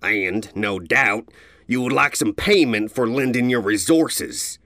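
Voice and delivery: lightly voiced gruff stallion; a hint of a proud caravan leader; gray stallion leader. A narrator's reading. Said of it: "Full Trot exclaimed".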